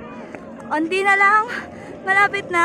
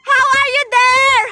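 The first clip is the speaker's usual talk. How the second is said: calling out; 5 semitones higher, 7 dB louder than usual. Real, not acted. Calling out to the others.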